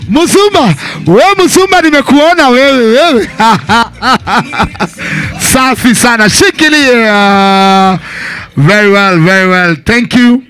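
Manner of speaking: loud party emcee shouting over music; hype MC. Acted. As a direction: pump up the crowd like a DJ host. MC hyping crowd over music.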